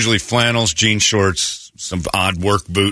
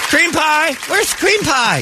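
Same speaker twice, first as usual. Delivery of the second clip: calling for the dog; slower than usual. Pretend. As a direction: call the dog's name across the park.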